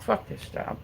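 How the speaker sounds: whispered, fed-up curse; whispered Fuck this job; under-breath curse. Retold or imitated. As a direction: hiss it quietly to yourself. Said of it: "I was just like".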